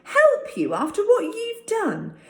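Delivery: very high and loud, indignant; furious shout. Acted. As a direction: hurl it at the enemy pilot. David's angry outburst at the German.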